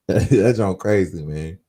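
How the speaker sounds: normal talking voice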